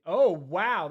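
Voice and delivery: sudden loud surprised wow. Real, not acted. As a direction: blurt out in surprise. Surprised by view count.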